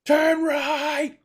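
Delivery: high, loud call to turn; shouted direction. Acted. Guide calling to the group to turn, loud shout. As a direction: shout it across the street.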